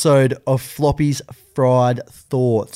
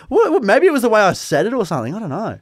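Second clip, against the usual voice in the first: ordinary talk, nothing marked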